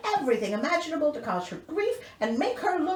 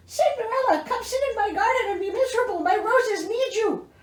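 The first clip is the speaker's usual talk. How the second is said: much higher, sing-song spooky call; mock tree voice; 10 semitones higher than usual. Reader voicing the tree calling Cinderella, high and eerie. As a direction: lure her into the garden.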